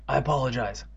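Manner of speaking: ordinary delivery, nothing marked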